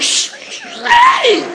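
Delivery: whispered shh then a very high shout; shivering cry. Retold or imitated. Preacher acting out the freezing night on the mountain. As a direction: gasp at the cold then cry out.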